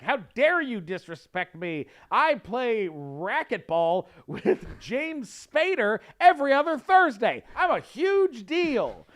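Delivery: indignant big shot. Retold or imitated. Disrespected celebrity boasting at an audition, grandly indignant. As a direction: draw out the brag and build the outrage.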